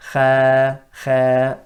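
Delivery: Kha thin, sound going down; Kha without heaviness; light Kha. Shown as a demonstration. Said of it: "that sound going downwards".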